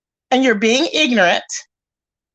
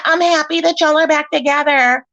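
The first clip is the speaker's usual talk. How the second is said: higher, with an audible smile; smiling voice; 3 semitones higher than usual. Real, not acted. Streamer congratulating the couple on stream, smiling and higher. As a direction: say it through a big smile, pitched up. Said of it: "Can you hear the smile in my voice?".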